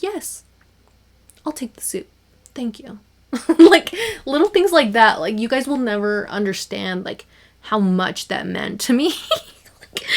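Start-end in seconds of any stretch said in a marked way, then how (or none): 0.0-3.0 much higher, soft and sing-song; bright little ordering voice; chirpy order. Retold or imitated. Acting out ordering proudly. 3.3-5.2 louder and higher through a laugh; breaking into giggles. Real, not acted. Laughing at her own story.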